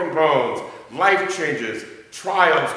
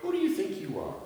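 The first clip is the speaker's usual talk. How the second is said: higher but softer pointed rebuke; God rebuking Job, higher and hushed; mock rebuke; 5 semitones higher, 8 dB quieter, faster than usual. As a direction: ask it like a scolding challenge. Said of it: "God is saying to Job".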